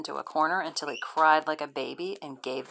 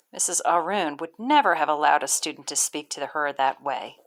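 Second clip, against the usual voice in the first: ordinary delivery, nothing marked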